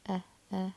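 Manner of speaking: "eh" isolated and said twice; short E sound. Shown as a demonstration. Teacher isolating the first sound of "any", short E "eh". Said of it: "kinda sounds like my short E sound".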